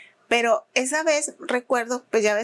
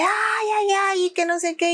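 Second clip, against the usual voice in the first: mock wail; 9 semitones higher than usual. Woman faking a fall moaning to the crowd. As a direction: whimper like you're hamming up pain. Lady fakes injury, wailing.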